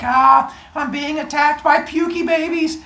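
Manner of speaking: high, hammed-up fright; mock panic. Kronos flees the pukey babies, mock panic. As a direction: yelp it in mock terror.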